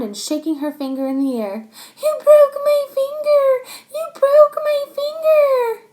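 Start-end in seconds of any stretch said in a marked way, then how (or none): 2.0-5.8 very high, loud crying wail; wailing accusation. Acted. Chloe wails at Max over her finger. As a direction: sob it out at him.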